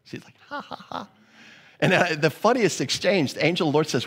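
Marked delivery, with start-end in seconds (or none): 0.5-1.1 high, soft hushed cackle; mock laugh. Sarah hidden in the tent, laughing softly and high. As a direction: giggle under the breath, high up. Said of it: "She starts laughing".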